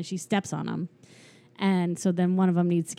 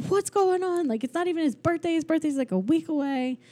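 mock freaking out; 9 semitones higher than usual. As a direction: imitate alarmed toys, voice pitched right up. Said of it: "everybody's freaking out".

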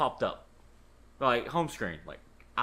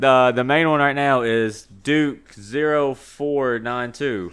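loud plug; 2 semitones lower, 8 dB louder, slower than usual. Real, not acted. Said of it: "he's plugging it".

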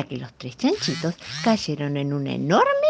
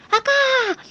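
high, loud cry from a rooftop; shouting for help; 8 dB louder than usual. Acted. The little piglet yelling from the roof for help. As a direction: yell down, stuck and scared. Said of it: "gritaba el pequeño chanchito".

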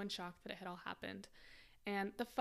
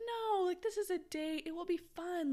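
imitating a pleading boyfriend, higher pitched; 9 semitones higher, 4 dB louder than usual. Boyfriend urging her to dress up for a date, mock pleading. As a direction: plead lightly in a high voice.

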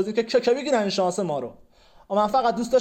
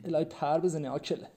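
soft, lower muttered insult under the breath; muttered curse; 7 semitones lower, 8 dB quieter than usual. Acted. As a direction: curse him quietly so he barely hears. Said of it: "چیزی گفتی؟".

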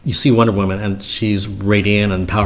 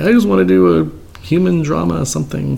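mock filmmaker; 8 semitones higher, 3 dB louder than usual. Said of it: "it's like".

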